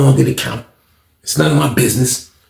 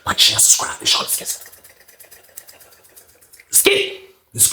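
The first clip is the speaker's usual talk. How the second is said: whisper; 7 dB quieter than usual. Real, not acted. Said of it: "whispered sign-off".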